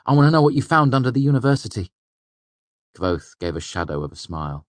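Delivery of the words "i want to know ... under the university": quick, loud, bright and eager. A narrator's reading. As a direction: rush it out, keen to hear. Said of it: "his face bright and eager".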